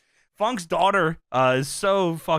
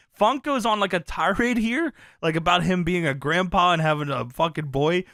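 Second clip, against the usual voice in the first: normal talking voice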